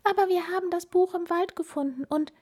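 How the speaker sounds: high, hurried excuse; piping little mouse, rushing; squeaky mouse. A narrator's reading. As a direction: explain fast in a tiny mouse voice. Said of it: "piepste die Maus".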